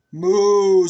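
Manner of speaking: big, loud mooing cow. Acted. Cow sound for the child, loud moo. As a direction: bellow it out like a cow.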